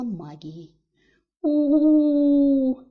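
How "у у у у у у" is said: громкий высокий вой, как оборотень на луну; завыть в отчаянии; протяжный вой. A narrator's reading. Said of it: "завыла я". (громкое высокое «у-у-у» в голос)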